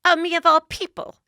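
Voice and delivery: high-pitched imitated indignation; hammed-up high retort; mock indignant reply. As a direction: answer in a put-on high, affronted voice. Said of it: "And she goes".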